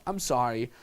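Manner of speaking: low, understated sorry; composed apology. Shown as a demonstration. Coach modelling empathy without being a beta male, composed apology. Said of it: "I understand. I can read your social cues".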